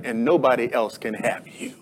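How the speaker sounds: hushed, possessive near-whisper; jealous. Acted. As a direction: drop low and soft, jealously possessive. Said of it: "says it in a very jealous way".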